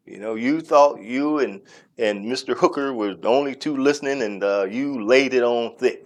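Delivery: ordinary talk, nothing marked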